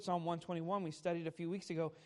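usual speaking voice

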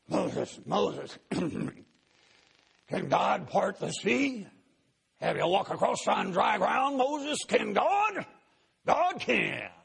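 rising to a high, loud preaching cry; gravelly old preacher. Retold or imitated. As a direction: thunder it from the pulpit like a revival preacher. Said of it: "with his gravelly voice".

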